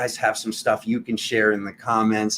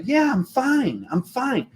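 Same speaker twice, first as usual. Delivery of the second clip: much higher, slow strained denial; squeaky fake I'm fine; forced fine; 12 semitones higher, slower than usual. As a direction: insist you're fine while clearly upset. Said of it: "and you're like".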